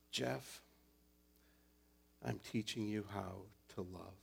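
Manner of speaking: very soft, gentle and low; tender inner voice. Acted. God's voice speaking to Jeff, tender and soft. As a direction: speak it tenderly, almost hushed. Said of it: "this voice said to me".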